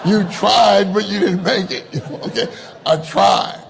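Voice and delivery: loud laughing punchline. Real, not acted. Speaker laughing at the rugby word try, loud giggly. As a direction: laugh through the joke. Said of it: "laughing at own joke".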